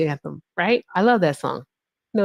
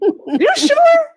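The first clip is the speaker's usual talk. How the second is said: squeaky, much higher and louder friend; doubtful friend; 6 dB louder than usual. Retold or imitated. Her friend pressing her at the bar, much higher and louder. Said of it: "She was like".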